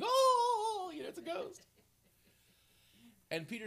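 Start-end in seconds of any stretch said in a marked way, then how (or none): 0.0-1.6 high mock-scared cry; hammed-up terrified disciple. Preacher mimicking bad movie actor's ghost scare. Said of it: "terrible acting".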